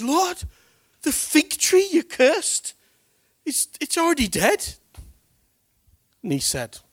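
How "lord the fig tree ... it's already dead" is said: much higher and louder, slow and wondering; amazed report. Retold or imitated. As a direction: call out to the Lord in astonishment. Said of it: "Peter said".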